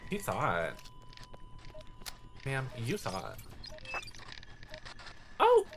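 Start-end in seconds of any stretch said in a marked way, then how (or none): none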